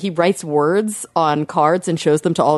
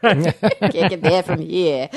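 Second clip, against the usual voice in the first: laughing, slowed mock New England accent; mimicked New England accent; much slower than usual. Teasing the host about sounding like JFK, mock New England accent. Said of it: "You sound like J F K".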